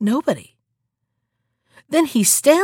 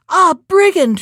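high, loud cry of brigand; angry cry; 7 semitones higher, 7 dB louder than usual. Acted. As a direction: shout at the wolf as you catch him. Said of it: "she cries".

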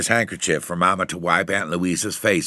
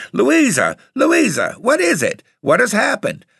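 high, loud frantic cries; Mama hollering in panic; hollering in alarm; 5 dB louder than usual. A narrator's reading. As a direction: call out urgently, voice shooting up. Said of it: "Mama hollering".

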